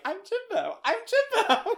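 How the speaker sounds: high squeaky goofy voice, laughing; goofy Jimbo voice. Retold or imitated. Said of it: "really we're like".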